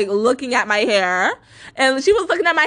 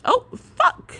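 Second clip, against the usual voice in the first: startled; 11 semitones higher than usual. Real, not acted. Startled by sudden scare.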